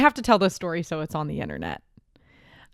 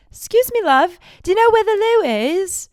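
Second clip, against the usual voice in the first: higher-pitched British man asking directions; British accent; 10 semitones higher than usual. Retold or imitated. British festival-goer asks a stranger for the toilet, in a higher British accent. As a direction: imitate a British stranger politely stopping someone. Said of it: "speak to each other in British accents".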